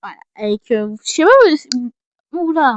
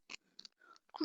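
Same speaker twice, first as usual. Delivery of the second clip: whisper; 25 dB quieter than usual. Real, not acted. Whispered aside while handling items.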